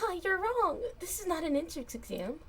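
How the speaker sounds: put-on high, loud cutesy girl voice. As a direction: play it sweet and cutesy. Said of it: "Why'd you make that cutesy voice".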